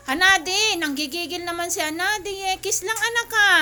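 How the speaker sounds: high, loud telling-off of a pet; loud scolding. Real, not acted. Owner telling Hanadi only to kiss, loud scolding. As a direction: raise your voice to correct the dog.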